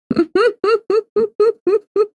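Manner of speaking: high-pitched pulsing giggle; helpless high laugh in short bursts. Real, not acted. Streamer cracking up at their synth joke, high giggling.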